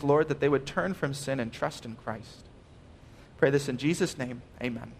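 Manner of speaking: hushed pastoral prayer; quiet prayer. Acted. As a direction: pray it humbly, turned inward. Pastor prays before the sermon.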